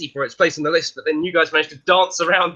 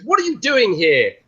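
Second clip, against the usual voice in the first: mock exasperated question; 6 semitones higher than usual.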